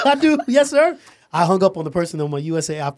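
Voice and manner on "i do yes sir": laughing. Retold or imitated. Laughing at own retold phone call.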